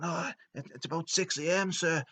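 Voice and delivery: high-pitched deferential servant voice; nervous underling. Retold or imitated. Domo telling the boss the time, nervous underling.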